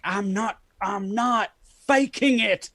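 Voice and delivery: protest, drawing out "faking"; mock indignant denial. Retold reply to fans' accent claim.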